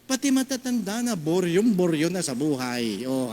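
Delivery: normal talking voice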